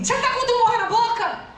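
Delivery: high-pitched mock pastor's question; blunt pastor. Pastor questioning a girl before everyone, blunt and high-pitched. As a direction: imitate a pastor's blurted public question.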